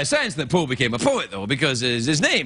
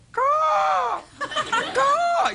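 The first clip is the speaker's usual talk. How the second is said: raven caw. Retold or imitated. Raven caw to the audience, high and shrill. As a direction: squawk it out like a big crow. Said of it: "They, they go".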